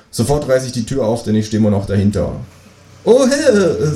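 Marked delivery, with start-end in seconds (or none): 3.0-3.9 high, startled kangaroo yelp; surprised kangaroo. A narrator's reading. Kangaroo finds door opened instantly, surprised. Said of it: "sagt das Känguru überrascht".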